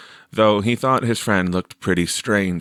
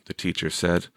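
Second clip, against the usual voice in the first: ordinary delivery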